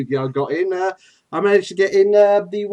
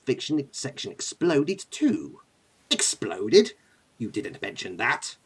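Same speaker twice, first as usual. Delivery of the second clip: slow, low, soft menace; Emperor Palpatine; 3 semitones lower, 5 dB quieter than usual. Acted. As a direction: read it as a sinister emperor, relishing each word. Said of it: "could you just read this".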